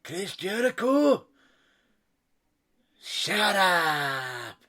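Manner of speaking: high, loud, slow yell; high-pitched shouted callout; mock shout. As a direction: bellow it at the wrestler. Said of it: "he turned around and goes".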